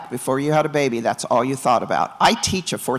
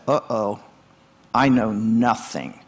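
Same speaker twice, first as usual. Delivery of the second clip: a low, slow, small "uh-oh" of a new mom who just realised; mock panic; 5 semitones lower, slower than usual. New mom holding her baby in the delivery room, low slow panic. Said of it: "That panic".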